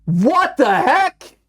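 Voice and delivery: high, loud stunned exclamations; shocked disbelief. Real, not acted. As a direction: react in total disbelief. Game suddenly turns creepy.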